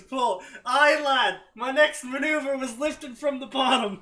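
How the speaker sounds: jokey Scottish voice reading a tweet; mock Scottish. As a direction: put on a broad Scottish accent for the quote. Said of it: "Scottish Twitter".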